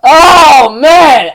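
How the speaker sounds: loud, high, drawn-out wail of frustration; exasperated cry. Real, not acted. Reviewer agonizing over the cliffhanger, loud exasperated cry. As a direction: cry it out, overwhelmed.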